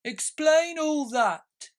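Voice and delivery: high, loud, slow creature demand; loud slow demanding turtle; Mock Turtle demanding. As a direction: insist like a pompous turtle.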